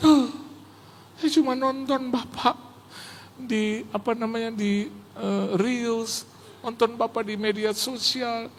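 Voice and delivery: high, awed retelling voice; imitating an overcome fan. Host pastor who only watched him on Reels, in an awed imitation.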